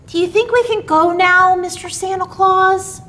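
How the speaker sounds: put-on high, eager small-boy voice; high child's voice asking; little Billy. A narrator's reading. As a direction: ask it like a little boy tugging Santa's sleeve. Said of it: "asked little Billy".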